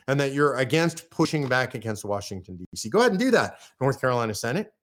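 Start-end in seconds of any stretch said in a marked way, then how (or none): none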